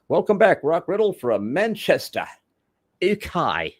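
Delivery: high-pitched British announcer from Manchester; put-on British accent. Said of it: "you're quite an impressionist".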